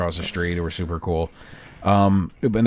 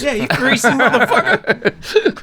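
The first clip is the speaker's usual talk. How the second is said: high slow laughing insult; mock yelling woman; 4 dB louder, slower than usual.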